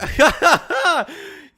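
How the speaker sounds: real loud high burst of laughter. Real, not acted. Friend hears the DM read aloud, loud high laugh.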